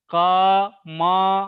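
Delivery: કમલ stretched very slowly, every syllable full, final અ sounded; slow careful demo. Shown as a demonstration. Teacher slowly showing the student how to say કમલ. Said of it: "ઉચ્ચારણ શું થાય?".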